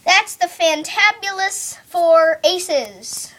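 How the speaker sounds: showman announcement. Acted. Announcing the trick's title.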